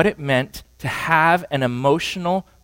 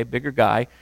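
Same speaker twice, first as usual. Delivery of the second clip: normal talking voice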